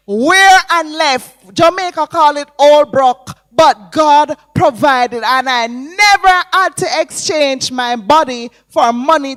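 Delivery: loud, slow, ringing preacher's cry over music; impassioned gospel preaching; full-force preaching. Acted. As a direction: preach it out to the congregation, building. Testimony rises into preaching.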